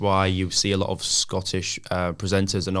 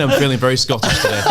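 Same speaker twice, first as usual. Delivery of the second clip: louder, giggling joke; laughing; 5 semitones higher, 6 dB louder than usual. Pretend. Joking feeling Scottish.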